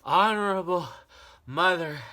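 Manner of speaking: put-on high, loud breathless child; gasping boy. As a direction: gasp the name out. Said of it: "he gasped".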